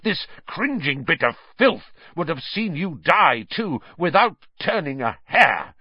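heated, emphatic contempt; snarling. A narrator's reading. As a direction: spit it out with loathing.

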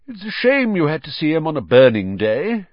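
put-on lilting elderly wizard teacher; Dumbledore, explaining fondly. A narrator's reading. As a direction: explain it to a pupil with relish.

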